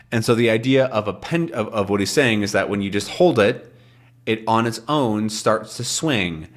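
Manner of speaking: ordinary talk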